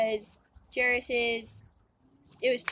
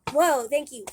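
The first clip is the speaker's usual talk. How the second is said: loud, amazed gasp of thanks; grateful and stunned; 3 semitones higher, 7 dB louder than usual. Retold or imitated. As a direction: blurt it out in wonder. Healed woman thanking Jesus, amazed.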